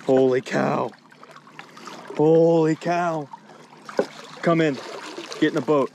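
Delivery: awestruck yell at a monster fish; amazed shout. Real, not acted. Hauling giant fish to boat.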